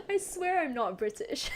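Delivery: words squeezed out between laughs; laughing denial. Real, not acted. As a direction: protest through giggles. Denies it while cracking up.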